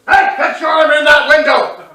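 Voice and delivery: loud, high mock dad shout; dad yelling. Dad loudly warning son about his arm out the window. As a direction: holler at the kid in the car.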